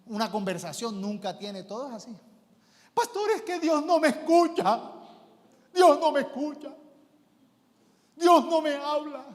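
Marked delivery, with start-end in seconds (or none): 2.9-9.2 high, loud whining lament; mock distraught churchgoer wailing to her pastor; wailing caller. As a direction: wail like nobody listens to you. Caller wailing God ignores her.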